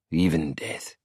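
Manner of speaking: ordinary delivery, nothing marked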